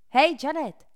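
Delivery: high, loud call across the steps. A narrator's reading. Cole calls out to Janet from the stairs: loud, high call. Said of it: "zavolal Cole".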